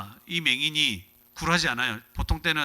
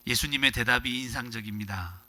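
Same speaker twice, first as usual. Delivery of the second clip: ordinary talk, nothing marked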